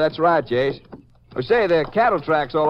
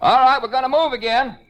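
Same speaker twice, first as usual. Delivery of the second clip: calling out; 8 semitones higher than usual. Acted. Ranger calling to the riders.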